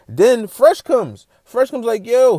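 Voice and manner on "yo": loud high call down the street; shouted yo. Retold or imitated. Fresh shouting to Ross from down the block, loud. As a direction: call out a greeting from far away. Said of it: "He shouted him from down the block".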